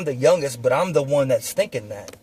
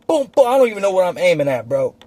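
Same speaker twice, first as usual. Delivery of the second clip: usual speaking voice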